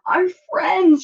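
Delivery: high, loud shocked gasp of a cry; Dog spotting the china chip, gasping in shock. A narrator's reading. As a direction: burst out in alarm. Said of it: "she gasped".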